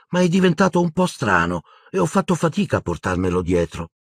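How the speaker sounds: a touch of dismay in the voice; desolate. A narrator's reading. Luna explains Ron's odd state to Harry, desolate. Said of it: "rispose desolata Luna".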